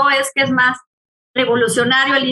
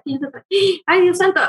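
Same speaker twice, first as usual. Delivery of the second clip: higher, startled exclamation; amazed; 6 semitones higher than usual. Retold or imitated. Mother reliving her shock at the hospital tag, higher. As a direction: act out being stunned. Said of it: "yo así estaba así y dije".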